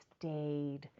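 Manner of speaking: plain reading voice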